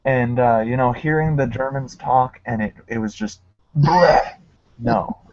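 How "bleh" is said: loud, raised mock-retch noise; disgusted bleh. Recalling the video that grossed them out, disgusted bleh.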